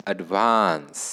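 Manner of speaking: "advance" with VANCE stretched and falling; stress on second syllable. Shown as a demonstration. Teacher pointing students to screen, "advance" stressed on VANCE. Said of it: "the stress is marked on".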